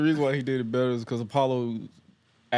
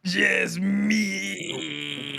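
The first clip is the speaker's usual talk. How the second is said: higher, drawn-out dramatic lament; wrestler impression; 5 semitones higher, much slower than usual. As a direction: cry it out like a tortured heel. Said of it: "He gonna be like".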